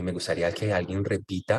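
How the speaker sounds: normal talking voice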